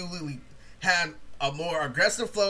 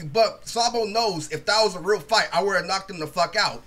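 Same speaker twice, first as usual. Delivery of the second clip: usual speaking voice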